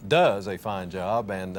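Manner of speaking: normal talking voice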